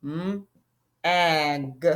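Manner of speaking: "mm, ag" as drawn-out separate sounds; sounding out. Shown as a demonstration. Teacher shows children the first syllable, "mm, ag" sounded out. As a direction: hold the "mm", pause, then slowly "ag". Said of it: "You see that?".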